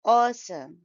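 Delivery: Awesome with AWE long and strong; weight on the first syllable of Awesome; stressed first syllable. Shown as a demonstration. Teacher models Awesome, stress on first syllable. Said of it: "stress is on the first syllable".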